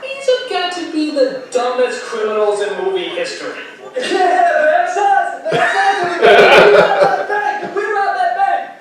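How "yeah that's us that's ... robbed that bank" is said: high, slow, goofy thug; dumb criminal voice. Retold or imitated. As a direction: gloat like a clueless crook.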